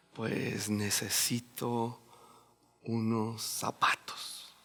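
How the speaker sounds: slow, soft whispered plea; mock timid whisper. A friend whispering to the preacher that he needs shoes. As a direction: whisper the need shyly and slowly. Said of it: "O que rojo me diga".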